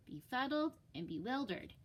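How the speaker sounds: ordinary delivery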